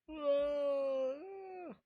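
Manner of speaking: talking through a yawn; words stretched by a yawn. Real, not acted. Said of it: "why do I keep freaking yawning".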